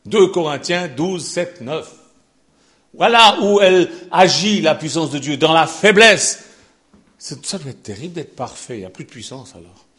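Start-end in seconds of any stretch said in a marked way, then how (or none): 3.0-6.4 fiery preaching. Acted. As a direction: thunder God's promise to the church.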